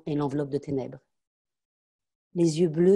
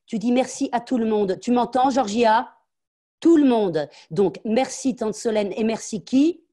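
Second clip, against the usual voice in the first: much higher and louder, hammering each word; furious scolding; 6 semitones higher, 8 dB louder than usual. Acted. Mother scolding her daughter on manners, much higher and louder. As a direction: drill the lesson into her.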